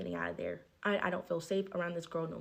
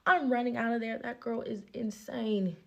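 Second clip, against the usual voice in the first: ordinary delivery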